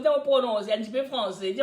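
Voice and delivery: ordinary talk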